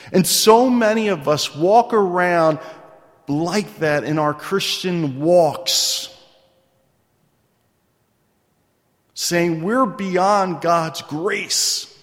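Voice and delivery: slow, loud, fervent preaching; impassioned preacher cadence. Acted. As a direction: drive the point home from the pulpit. Preaching at full force.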